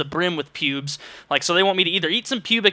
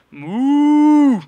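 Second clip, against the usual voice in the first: loud, high mooing; cow moo; 10 semitones higher, 7 dB louder than usual. Pretend. Answering the gas station attendant with a loud high moo. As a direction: moo right at them.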